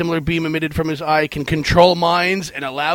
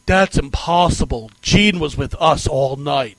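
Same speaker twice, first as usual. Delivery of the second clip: bad [name] voice; 2 semitones lower than usual. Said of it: "in that horrible [name] accent".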